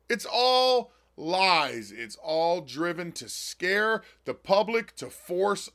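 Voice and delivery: very slow, "all" drawn out and hit; slow emphatic. Real, not acted. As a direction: drag out "It's all lies" word by word. Ranting at the government shutdown.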